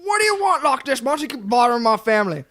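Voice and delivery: high, indignant cartoon complaint; South Park bit. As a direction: protest to the monster in a put-on voice. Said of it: "immediately I went to South Park".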